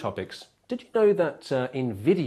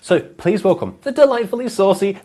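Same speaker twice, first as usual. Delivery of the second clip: booming talk-show announcer, louder, building hype; loud show host intro; 9 dB louder than usual. Acted. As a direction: bring on the guest like a TV host. Introduces the guest show-style.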